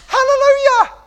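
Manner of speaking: shouted high and loud in triumph; jubilant hallelujah. Retold or imitated. Parent celebrating child's lesson.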